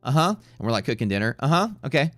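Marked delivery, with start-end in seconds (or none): 0.0-0.3 higher, airy mock uh-huhs; imitating her. Mimicking the dog owner nodding along at dinner, higher. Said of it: "And she'd be like". 1.4-2.1 higher, airy mock uh-huhs; imitating her. Mimicking the dog owner nodding along at dinner, higher. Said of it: "And she'd be like".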